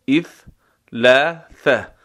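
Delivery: اثلاثة slow, with an added i- and the first th without a vowel; variant ithlatha. Shown as a demonstration. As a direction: show the colloquial اثلاثة slowly. (اثلاثة کشیده با همزهٔ مکسور)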